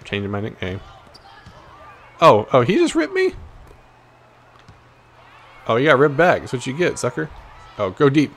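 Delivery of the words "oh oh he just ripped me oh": ordinary talk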